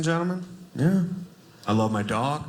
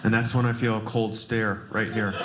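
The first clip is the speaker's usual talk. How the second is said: ordinary delivery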